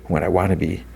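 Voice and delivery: ordinary delivery, nothing marked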